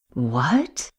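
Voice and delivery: low, quiet stunned question; shocked. Acted. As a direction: gasp it out in disbelief.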